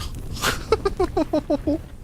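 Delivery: very high, soft helpless laugh. Real, not acted. Streamer laughing helplessly at the game, very high and soft. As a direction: laugh it off in despair.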